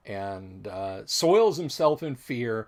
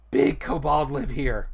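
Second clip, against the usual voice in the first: kobold voice; 3 semitones higher, 7 dB louder than usual. Acted. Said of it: "points to the ogre's cave and says".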